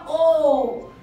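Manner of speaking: rounded ओ called out to the class; vowel sound o. Shown as a demonstration. Teacher to young students, demonstrating the vowel, rounded o. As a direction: say ओ with round lips. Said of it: "lip है वो ... गोल घूम जाएंगे".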